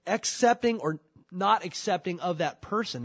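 ordinary talk, nothing marked

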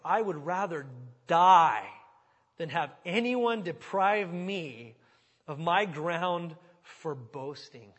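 slow and loud, leaning hard on die; emphatic scripture reading. Acted. Preacher reading Paul's vow to the church, slow and loud. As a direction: proclaim each phrase slowly from the pulpit.

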